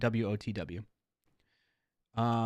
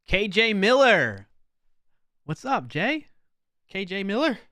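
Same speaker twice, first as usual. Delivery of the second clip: loud, high, drawn-out raid welcome; excited shout-out; 9 semitones higher, 10 dB louder than usual. Real, not acted. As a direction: hype the raider's name. Greeting an incoming raid.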